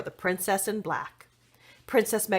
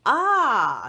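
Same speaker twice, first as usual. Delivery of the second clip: realizing; 4 semitones higher, 7 dB louder than usual. Acted. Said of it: "said Duff".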